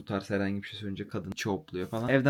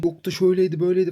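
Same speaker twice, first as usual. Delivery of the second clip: high, loud, fast mocking whine; mocking parody; 9 semitones higher, 9 dB louder, faster than usual. Tenant mocks the landlady's complaints, in a high, loud, fast mocking whine. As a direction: rattle it off like a silly excuse.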